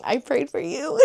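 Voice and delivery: lightly put-on sweet voice repeating it; sweeter repeat. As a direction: savour the line, playful and warm. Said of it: "I was like".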